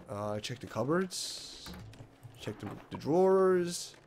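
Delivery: usual speaking voice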